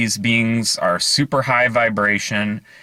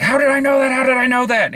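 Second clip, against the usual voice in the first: much higher and louder, near a squeal; frantic disbelief; 4 dB louder, faster than usual. Retold or imitated. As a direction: freak out at your own guess. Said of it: "lost it with that".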